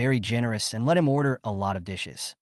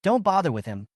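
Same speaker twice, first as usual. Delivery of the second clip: angry; 6 semitones higher than usual. Acted. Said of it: "Chun Qingqing said angrily".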